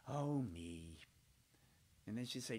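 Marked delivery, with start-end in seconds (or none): 0.1-1.0 low, dismayed mother's voice; mama's sigh. Retold or imitated. Mama reacts to the ridiculous remark, in a low dismayed sigh. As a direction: droop low like an embarrassed mother. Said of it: "my mama ... she said".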